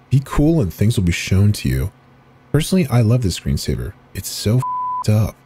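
ordinary delivery, nothing marked

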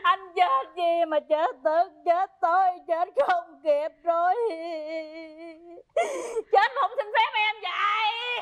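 hammed-up funeral wailing, loud; loud lament. Wife lamenting her husband's sudden death, wailing. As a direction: bewail each phrase at full voice, rising at the end.

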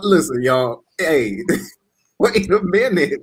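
ordinary talk, nothing marked

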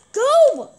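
sharp high yell to shoo them off; loud high command; shouting. Real, not acted. Shooing attacker in game.

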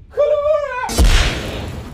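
high, loud, drawn-out yell of "Kulavora"; shouted spell. Pretend. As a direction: bellow the spell in frustration. Spell keeps failing, yells it.

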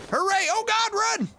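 high cheer turning to alarm; cheer, then shriek to flee; a high cheer that flips into panic; high excited cry. Real, not acted. Celebrating, then fleeing.

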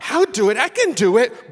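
put-on loud, high, stubborn little boy; determined child. Son Jazz on the ice keeps getting back up, loud and determined. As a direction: play the fallen kid insisting he can. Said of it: "He gets back up".

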